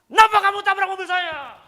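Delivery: furious high yell; full-volume, high-pitched roadside rage; acted angry confrontation. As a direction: shout accusingly at the other driver. Acts out confronting the other driver.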